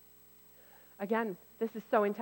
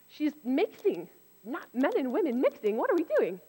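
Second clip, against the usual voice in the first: higher, louder mock shock at the mixing; mock scandalized; 6 semitones higher, 3 dB louder than usual.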